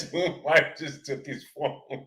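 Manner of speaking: very soft, slow words dissolving into laughter; laughing. Real, not acted. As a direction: barely get it out through real laughter. Cracking up at a chat message.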